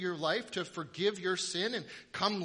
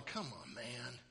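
softer, lower disappointed grumble; bored muttering; 5 semitones lower, 14 dB quieter than usual. Retold or imitated. Bored kids in the pews grumbling at the trick, softly. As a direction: grumble under your breath. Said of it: "us kids are all sitting there going".

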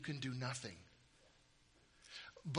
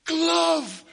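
much higher, shouted, slow command; booming magic command; 12 semitones higher, 12 dB louder, much slower than usual. Retold or imitated. Magician ordering the glove to point north, shouting. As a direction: bellow at the glove like a stage wizard. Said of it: "he'd be like".

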